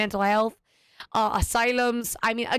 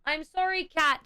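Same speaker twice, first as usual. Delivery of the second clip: tender apology to the cat; 5 semitones higher than usual. Real, not acted. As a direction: coo the apology up high to a pet.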